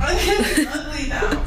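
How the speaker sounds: laughing through the words, slow and high; soft embarrassed laugh. Real, not acted. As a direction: giggle it out, embarrassed. Laughing during the procedure.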